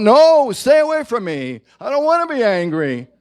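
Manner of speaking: a defensive, high, loud no; pushing away. Pretend. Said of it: "Instead of going".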